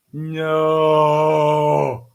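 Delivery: long, loud held wail of dismay. Real, not acted. Reader reacting to the parents' news, drawn-out dismay. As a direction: draw out a loud, pained no.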